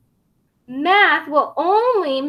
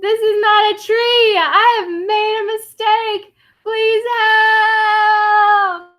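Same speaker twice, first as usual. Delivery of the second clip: higher, louder pleading alarm; mock panic; 9 semitones higher, 4 dB louder than usual. Tiny realises the tree is a dinosaur, mock alarmed. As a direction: call for help, hammed-up.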